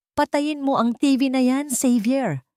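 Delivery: mother snaps at her son to turn it off; stern mother ordering the TV off; commanding. A narrator's reading. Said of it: "utos niya".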